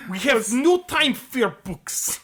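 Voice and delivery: put-on Russian accent. Friend doing Russian Reading Rainbow, mock Russian accent. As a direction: declare it like a cartoon Soviet official. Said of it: "Russian Reading Rainbow".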